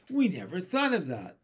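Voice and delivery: high, loud exclamation; excited storybook outburst; amazed cry. A narrator's reading. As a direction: cry it out as a dawning realization. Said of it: "they cried".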